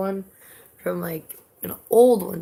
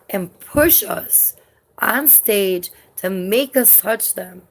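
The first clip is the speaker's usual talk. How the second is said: slow, heavy emphasis on each word; slow emphatic; 2 semitones higher, 4 dB louder, slower than usual. Real, not acted. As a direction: drag it out, outraged. Retelling the shoving guy.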